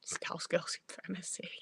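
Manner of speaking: soft whispered aside; hushed, voiceless little declaration. Real, not acted. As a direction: whisper it to the viewers.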